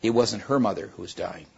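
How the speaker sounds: plain reading voice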